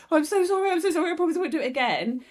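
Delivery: fast, high pleading sorry; frantic imitated begging; mock begging apology. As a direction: rush the apology like a panicked child. Younger self begging apology.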